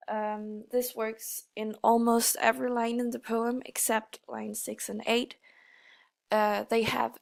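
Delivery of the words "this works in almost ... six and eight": usual speaking voice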